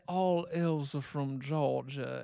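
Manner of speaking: slow Georgia accent; southern drawl. Shown as a demonstration. Host pitching elves from Georgia, slow southern drawl. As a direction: draw it out in a put-on southern accent. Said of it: "lovely southern drawl".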